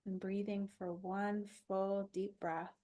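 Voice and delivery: calm body-scan guide with long pauses; even, unhurried relaxation voice; guided meditation. Acted.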